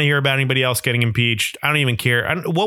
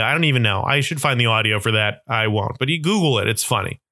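ordinary talk

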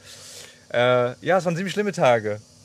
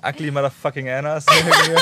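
laughing; 7 dB louder, faster than usual. Real, not acted. Jokey insult while laughing.

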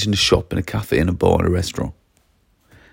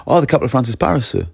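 higher, quick, cooperative full sentence; willing polite answer; 4 semitones higher, much faster than usual. Shown as a demonstration. Pupil answers teacher's capital question, willingly and politely. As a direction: answer readily and courteously, working with them. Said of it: "Are you working with me".

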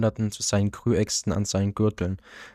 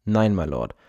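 plain reading voice

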